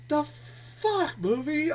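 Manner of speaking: high, puzzled disbelief; incredulous. Retold or imitated. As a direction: ask the movie in disbelief. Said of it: "And I'm like".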